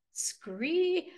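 high drawn-out "scree"; acted bird cry; hawk screech.

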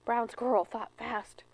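usual speaking voice

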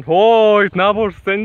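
a loud half-joking challenge; mock stranger's shout. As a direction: bark it like an angry local catching an intruder.

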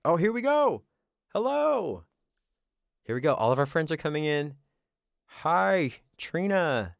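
loud high raid welcome; excited. Real, not acted. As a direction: greet the raiders, hyped.

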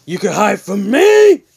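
loud, high, acted fury; enraged character yell. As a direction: build to a shout at a hiding enemy. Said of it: "Hazel's like".

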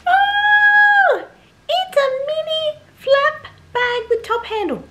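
very high, loud, squealing reveal; silly put-on voice. Vlogger revealing the new bag to viewers, silly high squeal. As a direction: squeak it out like an over-excited cartoon. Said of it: "I don't know why I was using that voice".

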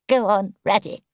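high, stricken little voice; tearful murmur. A narrator's reading. Toad in tears asks Rat to go on, murmuring. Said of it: "he murmured presently".

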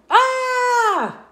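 high, loud startled shriek; a sharp, high scream of alarm; shocked scream. Acted. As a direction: let out a big startled scream. Penelope screams at the children.

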